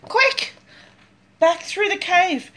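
high, urgent and breathless; panicked hurry. Acted. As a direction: rush them to flee. Fleeing the bear, high.

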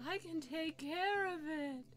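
character voice. Acted. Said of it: "plays a character".

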